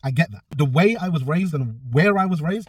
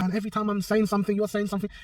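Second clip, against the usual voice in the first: high, quieter imitation of an arguing man; lightly mock his complaint in a higher voice; mimicked complaint; 8 semitones higher, 5 dB quieter than usual. Mimics opponent's complaint in retold argument.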